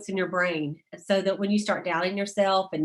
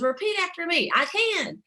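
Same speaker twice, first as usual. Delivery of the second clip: higher rallying call to the crowd; mock seminar yell; 9 semitones higher than usual. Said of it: "she would yell out".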